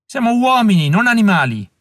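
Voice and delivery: a prisoner shouting at silent eyes. A narrator's reading. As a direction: yell it out, pushed past his limit. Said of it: "urlò Allen".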